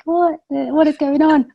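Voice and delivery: high, slow mock bewilderment; baffled disbelief. PhD student suddenly an entrepreneur, retold baffled disbelief. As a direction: draw it out, comically lost.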